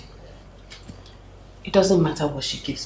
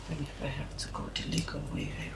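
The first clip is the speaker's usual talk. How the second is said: hushed whisper, faster; whispered promise; 13 dB quieter, faster than usual. Real, not acted. Mother whispering a promise to her child. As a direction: whisper it like a secret vow.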